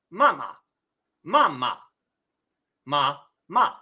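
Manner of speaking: 示范四声; Mandarin tones demo. Shown as a demonstration. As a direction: carefully model the four tones on mama, mama, ma, ma. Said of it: "the first two characters are the character for mother".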